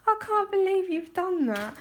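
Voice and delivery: high-pitched, real giggly disbelief; amused, barely holding the laugh back. Real, not acted. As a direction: say it while cracking up. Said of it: "I'm gonna stop laughing in a minute".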